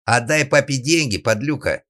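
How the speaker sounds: loud, high scornful call; shouted demand. Acted. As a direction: relay Father's order with an insult. Brother shouts father's demand.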